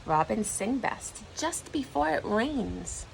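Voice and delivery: ordinary delivery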